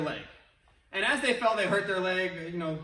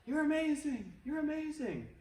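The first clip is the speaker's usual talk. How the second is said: high, repeated, dying away softer; fading echo; 8 semitones higher, 5 dB quieter than usual. Retold or imitated. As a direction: echo it back from the far hills. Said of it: "they heard back".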